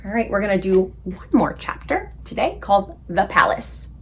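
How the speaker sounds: plain reading voice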